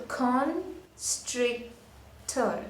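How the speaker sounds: constrictor very slowly as con, stric, tor; split into syllables. Shown as a demonstration. Teacher showing a three syllable word, split into syllables. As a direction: break constrictor into three separate beats. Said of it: "that's a three syllable word".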